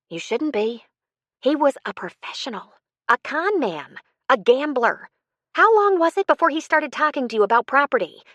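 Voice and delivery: animated, high-pitched dialogue; Jo, indignant reassurance. Acted. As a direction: raise the pitch and press the point. Novel dialogue, Jo speaking.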